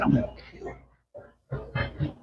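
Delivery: slow, breathless whispered threat. Retold or imitated. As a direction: hiss the threat slowly like a menace. Retold death threat from cop.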